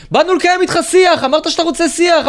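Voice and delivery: high, loud mock heckling; imitated shouting. Mimicking hecklers following a politician to his car, mock shouting. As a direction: parody protesters yelling their demand. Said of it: "צועקים, צועקים".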